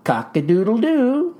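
crowing like a rooster; mock rooster crow. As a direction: give a hammy cock-a-doodle-doo. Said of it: "The rooster".

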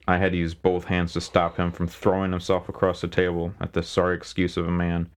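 plain reading voice

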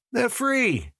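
very high, light chirp. A narrator's reading. Seller offering free replicas to dealers, chirping. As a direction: chirp it out, pitch way up. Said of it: "I chirped".

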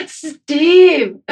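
high, loud happy shout; calling out. Real, not acted. Greeting a coworker across the room with a high happy shout. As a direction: hail him loudly across the room.

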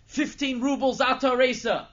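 full-force, high-pitched bidding shout; high, very loud holler; screaming out loud. Acted. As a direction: bellow it like a drunken bid across the room. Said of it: "he's screaming out loud".

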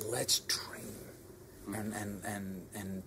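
a breathy whisper inside an imagined speech. Retold or imitated. Leader imagining telling his team to dream, whispered. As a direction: hush it like a secret invitation.

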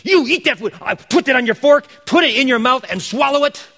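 high, loud, furious father at the dinner table; imitating an angry dad; angry dad yelling. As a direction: shout it like a dad losing it over dessert. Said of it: "Dad's now getting really angry and loud".

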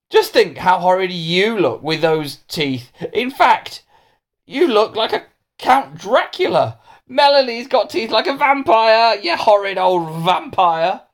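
high, drawn-out jeering; taunting schoolboy. Acted. Hamish taunts Melanie about her teeth, jeering.

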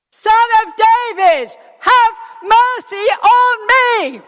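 very high, drawn-out full-force shout. Acted. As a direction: bellow the plea slowly, every word stretched. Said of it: "But he only shouted louder".

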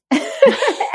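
high bright laugh. Real, not acted. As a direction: laugh at your own joke. Laughing at own joke.

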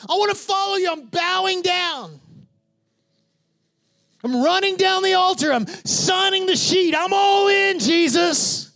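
shouted, high mock devotion; overeager convert. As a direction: ham up a gushing altar-call convert. Mocking eager convert at altar.

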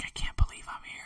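hushed, unvoiced whisper; awed whisper. Traveler seeing the lights on landing, awed whisper. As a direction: whisper it to yourself in disbelief.

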